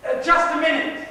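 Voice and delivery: loud, high interruption; calling a halt. Acted. Stopping two people leaving, loud halt. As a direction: cut in sharply at full voice.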